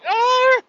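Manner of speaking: imitated shriek of a scared little girl, very high and loud; a short piercing scream; child's scream. Daughter screaming as puppy chomps her toy, imitated shriek. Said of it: "She was like".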